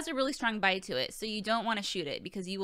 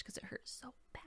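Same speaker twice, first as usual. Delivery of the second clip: whisper; 20 dB quieter than usual. Real, not acted. Embarrassed nail confession aside.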